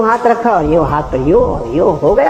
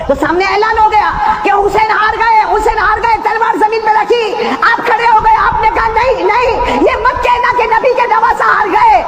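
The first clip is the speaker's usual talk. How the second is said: impassioned preaching; 14 semitones higher than usual. Acted. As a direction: cry out the defiance. Preaching Husain's defiance.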